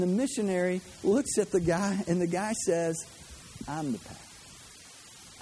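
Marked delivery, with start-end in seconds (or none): 3.7-5.4 slow, breathy near-whisper of a guide; hushed guide. Retold or imitated. Guide tells the missionary, in a slow hushed near-whisper. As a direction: say it softly and very slowly, like a secret.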